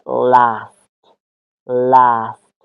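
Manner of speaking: "لاست" mit kurzem flachem A; American "لاست", short A; American accent. Shown as a demonstration. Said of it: "ساوند ا".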